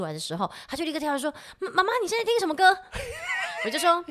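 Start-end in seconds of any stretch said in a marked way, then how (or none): 1.6-2.8 fast, high, shouting call; excited child. Retold or imitated. 2.8-3.6 squealing high laugh. Real, not acted. Laughs at own story.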